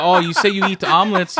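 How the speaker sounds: mocking reporter.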